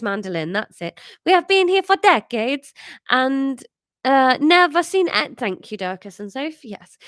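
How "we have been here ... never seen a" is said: high, loud Italian mother; Italian accent. Acted. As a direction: exclaim in a full Italian accent.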